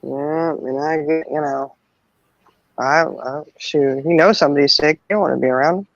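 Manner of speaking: usual speaking voice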